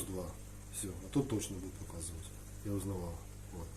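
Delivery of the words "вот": normal talking voice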